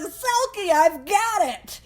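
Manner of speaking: high, slow triumphant announcement; excited drunk Bill. Retold or imitated. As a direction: proudly burst in with the news. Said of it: "He's like".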